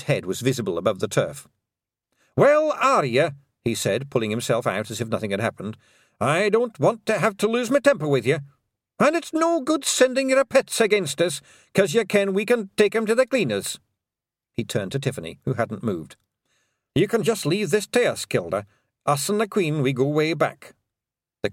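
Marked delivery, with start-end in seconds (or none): 2.4-3.3 high, loud Scots pictsie; cocky little Scots fighter; brash Scots pixie bluster; Rob Anybody. Acted. 6.2-20.7 cocky little Scots fighter; brash Scots pixie bluster; Rob Anybody. Acted.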